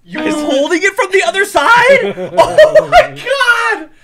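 very high, loud, laughing disbelief; shrieking laughter. Real, not acted. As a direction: burst out laughing in shock. Friend holds bottle wrong.

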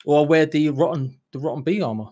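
ordinary talk

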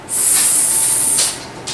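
long, soft hissed sss; drawn-out quiet snake sound. Shown as a demonstration. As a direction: imitate a snake hissing. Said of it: "The snake goes".

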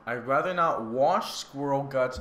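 ordinary delivery

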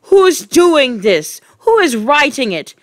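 high, loud demand; outraged shout at an unseen culprit; yelled. A narrator's reading. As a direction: yell it at nobody in particular. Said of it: "she yelled at nobody in particular".

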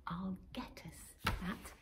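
soft secret promise; whispered resolve. Acted. Robin quietly resolves to fetch the star. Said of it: "she chirped".

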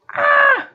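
very high, loud shriek; scream. Real, not acted. Player interrupted mid-game, high scream.

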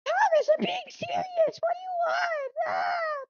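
little engine crying out as he is attacked; panicked crying engine. Acted. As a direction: wail in terror at the attacker. Jack cries out under attack.

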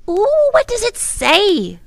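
put-on very high, loud little girl; high-pitched child, bursting to know; eager excitement. A narrator's reading. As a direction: squeal the question with drawn-out eagerness. Child eager to hear the definition.

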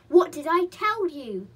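loud, high, slow scolding; angry. A narrator's reading. Chinky scolds Molly in the sea, angrily. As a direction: read an indignant I-told-you-so. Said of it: "said Chinky angrily".

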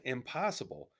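emphatic refusal, lightly southern French; southern French accent. A narrator's reading. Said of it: "said a man with a southern French accent".

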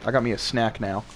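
ordinary talk

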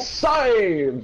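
high, drawn-out cheer; excited save call. Real, not acted. Player cheers a save in the match, drawn-out call.

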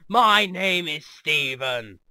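hammed-up grand introduction; mock booming introduction. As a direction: boom the name out, stretching it. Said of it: "he just goes".

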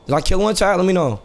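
normal talking voice

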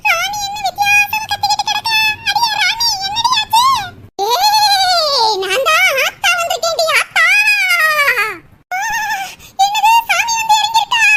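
put-on high alarmed old woman calling out; a shocked mother-in-law, pitched high; startled mother-in-law.

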